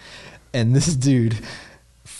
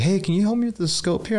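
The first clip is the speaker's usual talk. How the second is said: higher voice; 10 semitones higher than usual. Retold or imitated. Voicing [name]'s request.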